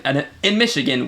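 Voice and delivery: ordinary talk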